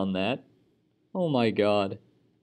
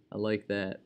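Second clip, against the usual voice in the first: ordinary delivery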